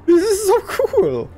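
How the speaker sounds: high, drawn-out gushing squeal; thrilled squeal. Real, not acted. Player gushing over a game they love, thrilled squeal. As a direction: gush slowly, voice soaring with delight.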